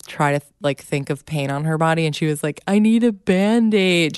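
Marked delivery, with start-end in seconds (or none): 2.7-4.2 imitating a child's plea, slow and higher; drawn-out mock-pitiful kid voice; sad little girl. Mom retells daughter inventing pain, sad and slow. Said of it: "made her so sad".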